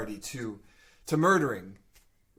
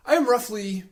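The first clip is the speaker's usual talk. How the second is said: in-character storyteller; 10 semitones higher, 5 dB louder than usual. Acted. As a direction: put on an aged Firbolg's voice, pitched up. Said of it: "should I do this in character voice".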